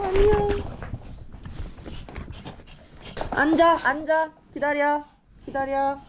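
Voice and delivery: firm commands to a dog; talking to a dog. Real, not acted. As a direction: give the dog clear sit commands. Commanding dogs to sit.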